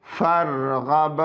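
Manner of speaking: फरगब slow with the final ब bounced; फरगब with qalqala at the end. Shown as a demonstration. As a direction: draw out फरगब, echo on the ब. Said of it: "बा हरूफ कलकला में से हैं".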